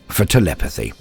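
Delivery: ordinary delivery, nothing marked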